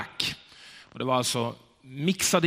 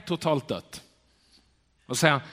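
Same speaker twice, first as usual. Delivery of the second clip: ordinary talk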